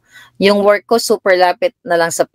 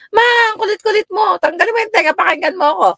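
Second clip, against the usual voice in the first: much higher, whining daughter; imitating Sheng; 13 semitones higher than usual. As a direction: whine at mom like an annoyed daughter. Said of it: "Gumaganon si Sheng". (ginagaya si Sheng na nagrereklamo)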